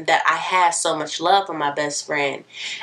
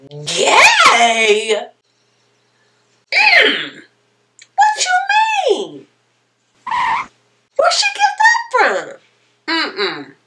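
put-on very high, loud, disbelieving old lady; grandma shocked; 5 dB louder, much slower than usual. Grandma hearing the news on the phone, high loud shock. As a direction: squawk it like a stunned grandma. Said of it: "my grandma on the phone saying".

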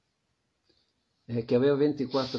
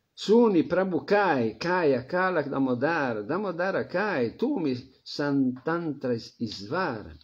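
scripture recitation; 3 semitones higher than usual. Acted. Recites Bengali scripture verse.

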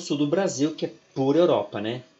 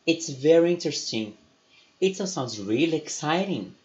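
slow, higher, careful dialogue reading; 4 semitones higher than usual. Acted. Teacher reads the lesson dialogue to students. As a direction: act out both sides of the dialogue clearly.

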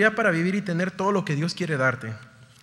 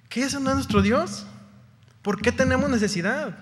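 higher, louder pointed questions; rising challenge; 5 semitones higher, 4 dB louder than usual. Real, not acted. Preacher challenging the congregation, higher and louder. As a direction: press them hard on why they lack.

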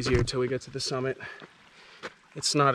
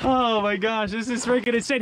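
excited; 7 semitones higher than usual. Real, not acted. Stunned at climbing terrain.

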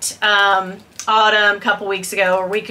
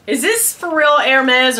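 usual speaking voice

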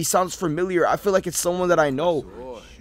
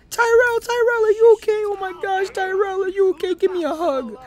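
much higher, fluttering concern; high worried friend. Acted. Friend to passed-out Tyrell, high anxious concern. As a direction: fuss over him in a high voice.

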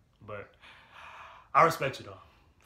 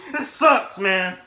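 loud, higher frustrated shout; angry yell; 6 semitones higher, 12 dB louder, much slower than usual. Real, not acted. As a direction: blast it out in disgust. Furious outburst at Patriots win.

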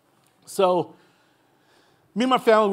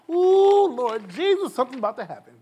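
high, loud rising praise; a preacher hyping up the coming blessing; excited anticipation; 6 semitones higher, 5 dB louder than usual. Acted. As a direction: burst out with expectant joy. Preacher acting out faith.

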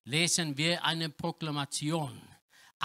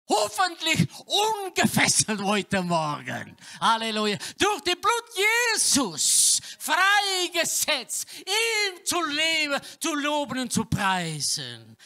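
higher and louder, shouted preaching; full-force preaching; 10 semitones higher, 6 dB louder than usual. Acted. Preacher proclaims God present, full-force preaching. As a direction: proclaim it to the whole room.

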